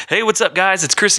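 high, hyped-up youth pastor greeting; upbeat youth pastor. Retold or imitated. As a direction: pump up the room like a youth pastor. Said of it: "I had the youth pastor voice".

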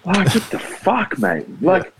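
what on earth are you thinking; exasperated disbelief at the selectors; incredulous outburst. Real, not acted. Outraged rant about team selection.

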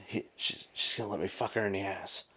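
hushed, breathy retold whisper; excited whisper. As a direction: whisper it like sharing a secret. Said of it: "he's all excited".

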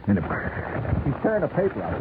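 usual speaking voice

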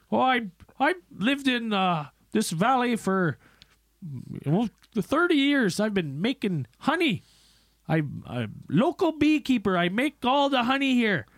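a hint of a country beekeeper; imitating a hillbilly beekeeper at a town hearing. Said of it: "typical hillbilly".

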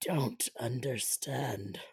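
whispered slowly, mock confused; breathless whisper. Jack confused by Mary, slow mock whisper. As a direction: hush it out like a lost lover. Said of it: "I, I, it's".

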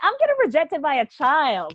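high, loud mock outrage; indignant quote. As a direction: play it up, offended by a kid. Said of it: "because I'm like".

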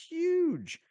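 HUGE drawn out, high and loud; stretched, excited high-pitched HUGE; emphatic hype. Real, not acted. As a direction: belt out a big high HUGE. Excited emphasis on playoff games.

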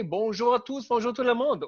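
high, bright-toned French hello to everyone; French greeting. Pretend. Host greets the listeners in French, playfully French. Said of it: "Or should I say".

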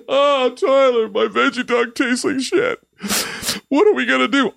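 high, whiny mock complaint; high whimpering mock lament; whiny Quentin. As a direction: play the friend whining about his sad veggie dog. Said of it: "Quentin is crying in his veggie dog".

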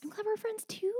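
squeaky sweet echo; high-pitched echo. Acted. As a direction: echo her in a tiny high voice. Echoing sentimental friend line.

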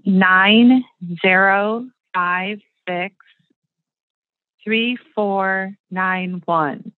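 slow, each digit spaced out; reading digits one by one. Shown as a demonstration. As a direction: read the digits slowly and clearly. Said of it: "Are you ready for the target number?".

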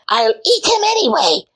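high shrieking witch voice; screaming witch. A narrator's reading. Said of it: "she screamed at Gretel".

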